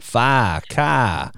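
mock thick Boston accent. Shown as a demonstration. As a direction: drop the R's like a Bostonian. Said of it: "expecting a thicker accent".

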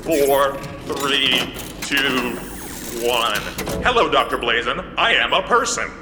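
boot up like a machine, counting down; robot booting up. Acted. Robot sits up, it just goes.

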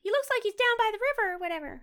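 put-on high-pitched kid voice; Reese. Eleven-year-old describing the stranger to the mechanic, little boy voice. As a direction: chatter as a mock little boy. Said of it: "Reese is just like".

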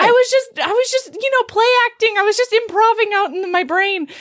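high-pitched, stammered backpedalling; high, embarrassed babble; mock flustered excuses. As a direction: scramble for an excuse in comic panic. Boy's frantic excuses acted out.

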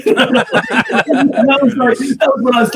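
high, drawn-out laughing through words; giggling at the memory. Real, not acted. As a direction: laugh it off as you speak. Laughing at remembered put-down.

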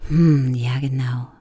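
breathy hum then a whispered ja genau; whispered agreement. Shown as a demonstration. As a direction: hush it, agreeing. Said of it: "so, weißt du, diesen".

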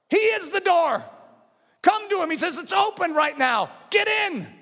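high, shouted sermon exhortation; urgent, punched pulpit call; full-force preaching. Real, not acted. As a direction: cry it out to the congregation.

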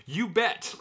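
high, eager "you bet"; excited cry. A narrator's reading. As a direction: shout it out, thrilled. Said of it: "you cry".

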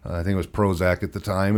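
ordinary delivery

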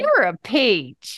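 slow, stretched-out Southern twang; Southern drawl. Pretend. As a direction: draw it out in a playful Southern accent. Playing along with Southern sayings.

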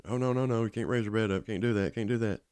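alarmed nurse. Retold or imitated. Hospital staff stop him raising bed.

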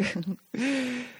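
quiet giggle at herself; soft chuckle. Real, not acted. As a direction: laugh at the slip. Laughs at own flub.

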